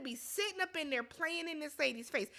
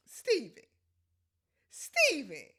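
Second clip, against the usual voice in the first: loud scolding call; 6 dB louder, slower than usual. Real, not acted. Scolding the man on screen.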